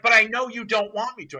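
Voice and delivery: protesting client. Retold or imitated. Client arguing with trainer over the hike, mock protest. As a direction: imitate her pushing back loudly. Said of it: "She's like".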